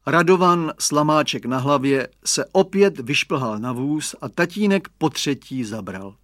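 ordinary delivery, nothing marked

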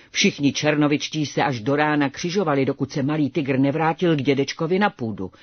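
usual speaking voice

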